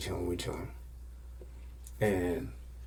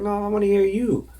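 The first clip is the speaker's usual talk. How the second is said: put-on higher, louder girly voice; girl's voice; 12 semitones higher, 8 dB louder than usual. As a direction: play the girl asking eagerly. Girl turns to him.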